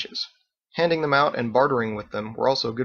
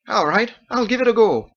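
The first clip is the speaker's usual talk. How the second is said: high, bright-toned Irish-style hostess; Margot's lilting voice; 11 semitones higher, 6 dB louder than usual. A narrator's reading. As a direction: play the chatty innkeeper woman, high. Margot game.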